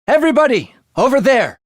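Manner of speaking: high loud shout to the group; calling out. Acted. Warning the team of enemies, loud call out. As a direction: yell to point everyone's attention.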